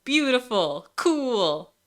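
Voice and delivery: high, bright delight; excited. Real, not acted. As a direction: gush over the result. Admiring the working model.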